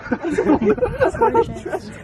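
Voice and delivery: slow, high, laughing amazement; delighted awe. Real, not acted. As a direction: marvel at it with a laugh. Admiring the view with laughter.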